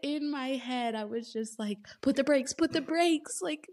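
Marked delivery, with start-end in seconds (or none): 2.0-3.4 raised, comic warning that climbs on the repeat; mock urgent plea. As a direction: try to halt her, pitched higher each time. Reader's inner plea to the heroine.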